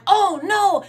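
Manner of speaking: sudden dismay as ducklings scatter; a startled yelp of alarm. Retold or imitated. Ducklings scatter near road.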